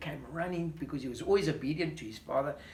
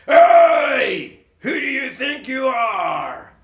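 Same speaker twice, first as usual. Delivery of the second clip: angry shout; 8 semitones higher, 17 dB louder, much slower than usual. Acted. Giant challenges David.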